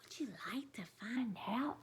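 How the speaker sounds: fast hushed whisper; teasing whisper. Real, not acted. Reader to children, whispering a teasing question. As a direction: whisper the invitation.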